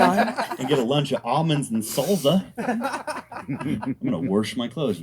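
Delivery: slow mocking hillbilly accent; sarcastic hillbilly drawl. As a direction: drag out the hillbilly pronunciations. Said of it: "fucking hillbilly".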